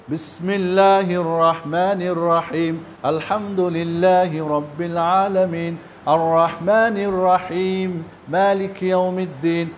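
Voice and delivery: slow, measured Quran recitation; slow tartil recitation. Shown as a demonstration. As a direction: recite each word slowly and deliberately. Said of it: "এটা হলো তারতিল পড়া।". (ধীরে ধীরে তারতিল তিলাওয়াত)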